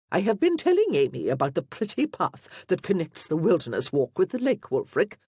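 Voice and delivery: loud, imperious aristocratic lady; commanding marchioness. A narrator's reading. Marchioness instructs the Duke about the walk, commanding. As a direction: project it as one used to being obeyed. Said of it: "in a voice that was clearly accustomed to command".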